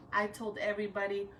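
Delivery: ordinary delivery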